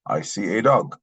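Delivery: ordinary delivery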